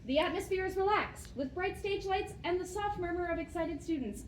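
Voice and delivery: usual speaking voice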